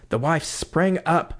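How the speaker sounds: plain reading voice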